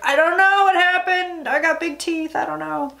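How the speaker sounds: hammed-up clueless Giuliani, barely changed from her own voice; Giuliani impression. Vlogger mocking Giuliani as clueless about the fire, weak imitation. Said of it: "my god-awful Giuliani impression".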